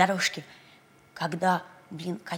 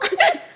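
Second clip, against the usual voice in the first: high squealing laugh; piggy laugh; 10 dB louder than usual. Acted. Pig laughs.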